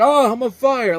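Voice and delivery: high, loud mocking yell. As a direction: imitate an actor's unconvincing yell of alarm. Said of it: "yelling because he's on fire".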